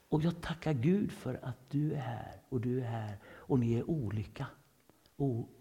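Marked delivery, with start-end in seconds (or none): none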